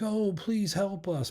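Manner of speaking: high, slow, syrupy fake plea; high slow decoy plea; fake pleading. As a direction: lure the kids with a sweet false plea. Aliens faking a plea for help.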